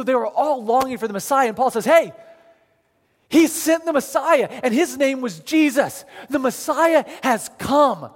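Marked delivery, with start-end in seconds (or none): 1.8-8.1 higher, emphatic announcement with a slow landing; excited proclamation. Acted. Paul telling the congregation the Messiah has come, excited proclamation. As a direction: proclaim the long-awaited news.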